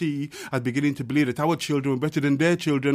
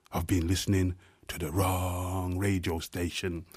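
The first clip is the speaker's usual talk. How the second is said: much softer and lower, drawn inward; hushed refrain; 10 semitones lower, 10 dB quieter than usual. Acted. Poet sinking the refrain to a hush, softly. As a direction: let the refrain sink almost to a murmur.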